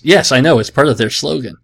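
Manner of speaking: usual speaking voice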